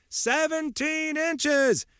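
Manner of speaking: slow, high, drawn-out group answer; crowd in unison. Acted.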